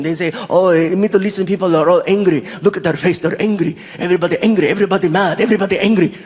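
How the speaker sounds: put-on insistent Saudi-accented complaint; mildly exasperated Saudi man; Saudi local. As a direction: list it off in an Arabic accent. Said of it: "he starts breaking it down".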